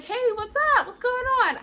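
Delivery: friend's voice lifted high and chirpy; perky high greeting; high bubbly friend voice. Retold or imitated. Best friend calling back, high cheery hello.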